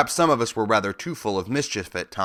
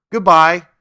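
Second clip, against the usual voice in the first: louder, bright farewell call; loud goodbye; 7 semitones higher, 11 dB louder than usual. A narrator's reading. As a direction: call it out while running off. Mouse queen scampers off.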